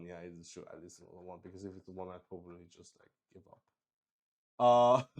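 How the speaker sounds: ordinary talk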